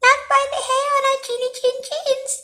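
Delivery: very high, quick squeaky piggy voice; squealing little pig. A narrator's reading. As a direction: squeal it fast like a tiny pig. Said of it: "squealed the three little pigs".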